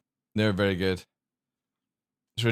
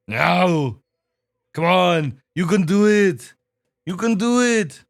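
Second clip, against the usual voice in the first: loud, higher action-hero urging; Arnold; 6 semitones higher, 7 dB louder, slower than usual. Acted. Owner urging his husky to howl, loud Arnold voice. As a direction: bark encouragement in a hammed-up accent. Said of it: "It's the Arnold voice".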